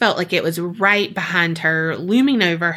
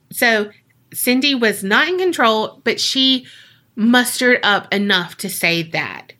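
ordinary delivery, nothing marked

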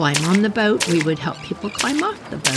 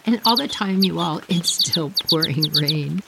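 normal talking voice

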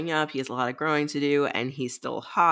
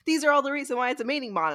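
mock eager insistence; 9 semitones higher, faster than usual. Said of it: "you guys were like".

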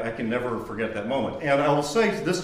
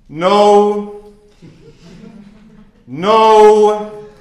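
high, loud flat refusal; stern professor's no; 10 semitones higher, 15 dB louder than usual. Retold or imitated. Professor shooting down a student's answer, loud and high. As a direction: bark out a loud no.

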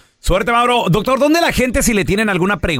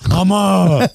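put-on little boy voice calling his mom; mock child calling out to his mother; kid voice. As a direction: squeeze the voice like a seven-year-old. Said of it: "Así era, era así".

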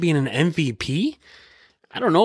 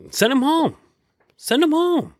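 exasperated; 12 semitones higher than usual. Real, not acted. Ranting at refs, pitch jumps.

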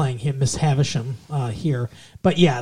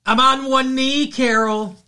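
speaking from off the mic; away from the microphone; 10 semitones higher, 4 dB louder than usual. Real, not acted. Proposing to girlfriend on one knee, off-mic. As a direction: call across from away from the mic. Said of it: "away from the microphone".

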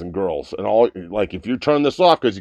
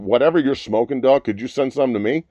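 normal talking voice

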